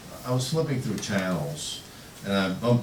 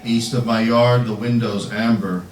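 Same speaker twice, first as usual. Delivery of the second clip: slow, louder poem recitation; poetry reading; 4 semitones higher, 8 dB louder, slower than usual. Acted. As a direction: project the verse in deliberate, separated phrases. Reads own poem aloud.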